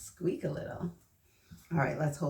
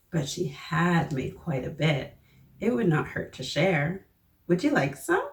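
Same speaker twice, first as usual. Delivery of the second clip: lively, louder picture-book storytelling voice; reading to children; 6 dB louder than usual. Acted. Teacher reading a picture book aloud to the children, lively storyteller voice. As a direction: read it out big and bright for the little ones.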